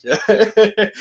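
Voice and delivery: laughing through a yeah; giggling, high and breathy. Real, not acted. Still laughing at the joke.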